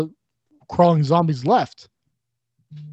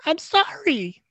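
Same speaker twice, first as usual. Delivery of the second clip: meek apology; 12 semitones higher, 3 dB quieter, much slower than usual. Retold or imitated. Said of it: "And he's like".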